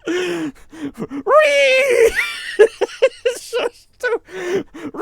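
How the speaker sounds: loud, very high squeals through laughter; hysterical laughing. Real, not acted. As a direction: try to speak while cracking up helplessly. Helpless laughing fit during play.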